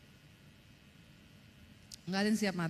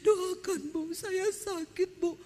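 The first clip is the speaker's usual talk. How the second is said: higher, slow, whimpering plea; tearful plea; 8 semitones higher, much slower than usual. Retold or imitated. Sick woman begging the preacher to pray for her, mock tearful. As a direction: imitate a frightened woman begging through tears. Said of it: "ibu-ibu datang nangis-nangis".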